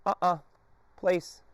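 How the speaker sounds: high sharp no-mark then cue; correction. Shown as a demonstration. Trainer correcting the dog, then cueing. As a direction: stop him, then redirect. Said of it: "I am saying no".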